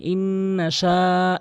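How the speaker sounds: ইন্না শা with the sha held long; drawn-out sha. Shown as a demonstration. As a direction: stretch শা by one alif. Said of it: "এভাবে টেনে পড়বেন".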